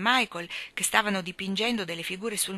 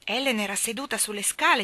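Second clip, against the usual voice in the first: plain reading voice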